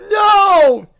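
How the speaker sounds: normal talking voice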